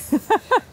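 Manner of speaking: high laugh. Real, not acted. Laugh while coaxing horse.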